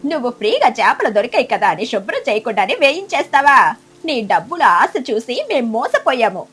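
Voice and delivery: angry. Acted. Eagle scolding the duck for careless cooking, angry. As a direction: tear into the cheating cook. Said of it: "మహీక్రద్ద కోపంగా".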